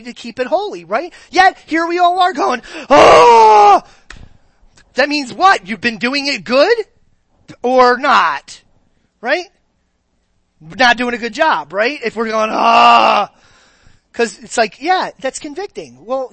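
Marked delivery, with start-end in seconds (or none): 2.9-3.8 loud, high mock gasp-shout of guilt; a sudden hammed-up "Oh!" of being caught out; caught-out exclamation, much louder and higher; startled shout. Congregation reacting to being convicted. 12.5-13.3 loud drawn-out "Ah" of guilt; a hammed-up groan of being convicted; mock pained cry. Congregation reacting, convicted.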